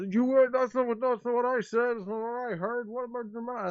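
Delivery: indignant villager. Acted. Villager disputing the mayor's version, indignant. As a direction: argue back, offended.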